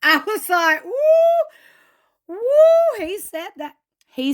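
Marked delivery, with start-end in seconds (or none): 0.8-3.0 very high, loud gossip hoot; excited hoot. Real, not acted. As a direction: whoop like the tea just spilled. Reacting to Cody's tea spill.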